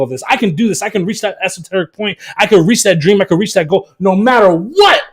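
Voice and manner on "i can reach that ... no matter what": usual speaking voice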